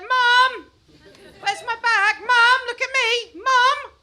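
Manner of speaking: put-on high, loud nagging kid voice; whiny kid calling. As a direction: nag mum over and over like a needy child. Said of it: "They're like".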